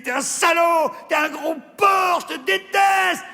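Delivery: high shrieking insults; furious shout. Acted. Wife screaming at her husband in the taxi, high and furious.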